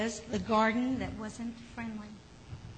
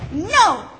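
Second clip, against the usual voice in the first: higher, much louder no; shouted; 6 semitones higher, 11 dB louder than usual. A narrator's reading. Tomatoes refuse the bush, loud higher shout. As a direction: throw out a big storybook no. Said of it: "shouted the tomatoes".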